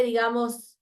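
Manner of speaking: ordinary talk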